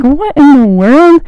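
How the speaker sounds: slow, high, loud disbelief; a drawn-out high what in the world; baffled. Retold or imitated. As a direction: stretch it out in disbelief. Baffled quote of herself.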